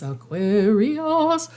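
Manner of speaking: "Aquarius" stretched slowly, syllable by syllable; playfully drawn out. Real, not acted. Reader announcing the next sign, "Aquarius" playfully stretched. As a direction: draw "Aquarius" out long, playing with the sound. Playfully repeats the sign's name.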